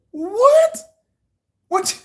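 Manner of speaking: high disbelieving what; incredulous what. Real, not acted. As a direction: shoot up in pure disbelief. Reacting to absurd complaint.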